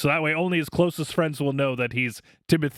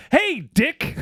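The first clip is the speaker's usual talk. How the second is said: bright, much higher and louder hello; exuberant greeting; 11 semitones higher, 4 dB louder than usual. Retold or imitated. Bar manager greeting the liquor rep's voicemail, exuberant. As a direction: greet him with over-the-top cheer. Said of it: "like, so exuberantly".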